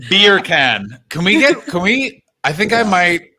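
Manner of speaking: ordinary delivery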